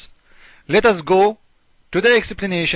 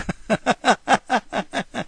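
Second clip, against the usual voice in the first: real amused laugh; 5 semitones higher than usual. Real, not acted. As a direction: break into a short laugh. Laughs at the verse.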